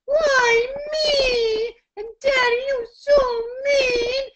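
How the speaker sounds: put-on very high, loud, crying child's whine; sad whine. Shown as a demonstration. Voice teacher demonstrating a sad whiny child. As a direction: whine sadly like a hurt child. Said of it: "sort of sad rather than angry".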